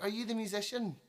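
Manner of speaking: high-pitched mock lady's question; pushy lady. Lady walks up to the musician on stage, high mock voice. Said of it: "she's like".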